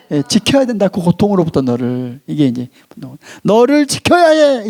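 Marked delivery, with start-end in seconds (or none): 3.4-4.7 slow, higher-pitched urgent insistence; 분노의 메시지. Acted. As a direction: press the message home, drawing out each word. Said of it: "이 메시지를 던지는 게". (길게 끌며 높게 강조하는 호소)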